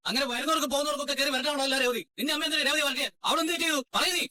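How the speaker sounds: high-pitched indignant demands; angry confrontation. Acted. Family quarrel over wife being scolded. (ഉയർന്ന സ്വരത്തിൽ രോഷത്തോടെ)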